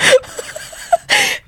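laughing sob. Real, not acted. Laughing while recounting the shock.

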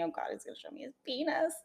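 high, hushed dread played for laughs; quick, high and soft mock dread; funny dismayed thought. Dreamer telling her friend what she feared he'd show, funny dismayed thought. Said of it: "the way you said that was funny".

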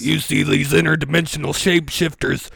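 loud conspiracy-host rant, building; mounting mock outrage on air; Alex Jones. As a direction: testify like a ranting broadcaster. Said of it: "It sounds like an Alex Jones take".

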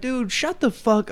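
high, mock furious yelling; telling the old driver to shut up, mock angry; retold angry yell. Retelling road rage yell.

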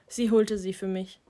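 ordinary delivery, nothing marked

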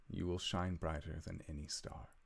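a hint of a proud, tender father saying goodbye; gently voiced father, understated; lightly voiced loving father. Father says farewell to his son Calum, lightly voiced and tender. Kaharic's last words.

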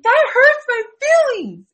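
high-pitched, hammed-up wail of hurt; tearful mock whine. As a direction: imitate yourself crying, voice way up high. Retelling own tearful protest.

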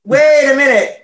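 loud mock astonishment; loud double take. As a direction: call it out, floored by her looks.

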